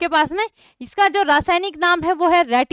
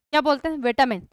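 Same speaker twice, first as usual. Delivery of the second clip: ordinary delivery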